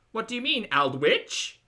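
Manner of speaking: high, loud upspeak, "Aldwych" rising at the end; Australian question intonation. Shown as a demonstration. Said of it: "Australian question intonation".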